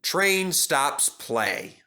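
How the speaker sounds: ordinary delivery, nothing marked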